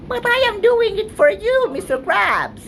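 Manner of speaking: very high, squeaky cartoon voice; high-pitched cartoon kid voice; SpongeBob-style squeak.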